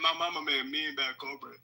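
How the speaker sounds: quick put-on Southern accent. Bragging to the group about mama's cooking, Southern accent. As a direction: toss it off in a mock Southern accent.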